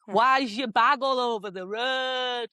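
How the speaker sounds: slow, drawn-out northern English call; Georgie. Retold or imitated. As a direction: imitate a northern English neighbour calling out. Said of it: "another northerner from next door".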